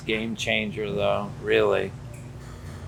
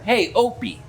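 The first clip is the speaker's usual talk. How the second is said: calling out; 10 semitones higher than usual. Retold or imitated. Calling boy from thirty feet.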